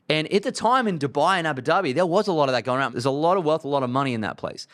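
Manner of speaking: usual speaking voice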